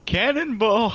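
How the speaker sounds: excited yell, high and loud; cannonball yell. Pretend. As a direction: shout it while leaping into the pool. Jumping off the diving board.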